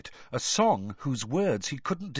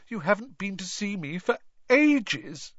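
put-on high, whiny girl ghost; Myrtle's ghost voice; 10 semitones higher than usual. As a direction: pitch up into a mopey schoolgirl ghost.

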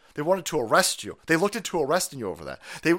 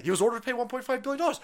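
mock straight-faced report; 8 semitones higher, much faster than usual. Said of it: "They say it with a straight face".

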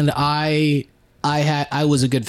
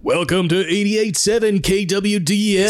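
smooth radio DJ intro voice; radio announcer voice; 4 semitones higher than usual. Retold or imitated. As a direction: announce it like a station ident. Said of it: "That voice is incredible".

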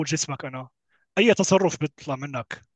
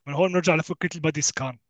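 usual speaking voice

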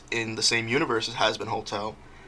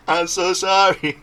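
high, loud, drawn-out playful sorry; laughing mock apology; 7 semitones higher, 6 dB louder, slower than usual. Host to the absent woman whose name they forgot, laughing mock apology. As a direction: plead forgiveness while giggling.

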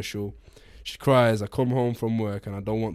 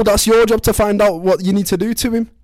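high, loud, upset put-on Scouse voice; distraught wife; 9 semitones higher, 9 dB louder than usual. Wife begs husband for help, high distraught voice. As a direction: play the wounded wife, pitched high and loud.

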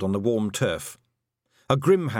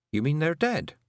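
ordinary delivery, nothing marked

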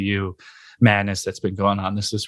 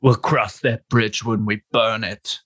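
menacing Butcher; slower than usual. Retold or imitated. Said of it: "you need a little more of the, uh, the rasp".

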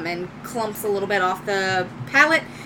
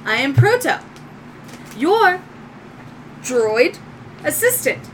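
higher, halting robot voice with pauses; robotic droid; 5 semitones higher than usual. Acted. Newly woken droid introduces itself to owner, halting robot voice. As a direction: announce yourself word by word as a robot. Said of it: "it goes, "Hello".

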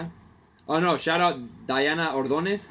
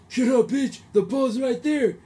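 put-on higher, louder, slow drunken yell; imitating drunk heckler; 11 semitones higher, 6 dB louder, slower than usual. Drunk fan on the bench yelling at the player, slow mock shout.

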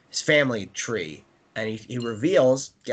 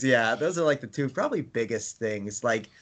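usual speaking voice

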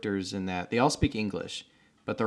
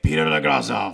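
put-on low, loud, German-tinged Russian accent; hammed-up Russian accent, loud and forceful; aggressive Russian accent; 5 semitones lower, 7 dB louder than usual. Imitating the Russian bureaucrat from Chernobyl, aggressive Russian accent. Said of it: "aggressive Russian accent".

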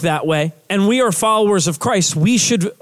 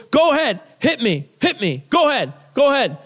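mocking, cocky dare; arrogant taunt; 4 semitones higher than usual. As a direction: goad her like you're untouchable. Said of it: "screamed of arrogance".

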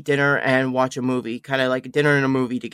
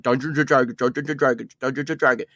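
tongue-tied, stumbling over the words; stuttering; faster than usual. Shown as a demonstration. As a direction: trip over the phrase each time. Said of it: "I keep stuttering over".

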